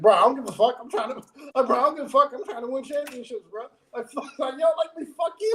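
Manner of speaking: high-pitched, exasperated mock voice; C M Punk. Fan voicing a wrestler brushing off his hateful rival, mock exasperation. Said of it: "C M Punk's like".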